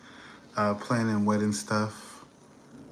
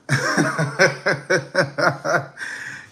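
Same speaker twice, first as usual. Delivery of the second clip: loud laugh; 8 semitones higher, 6 dB louder than usual. Real, not acted. Laughs after vowing not to return.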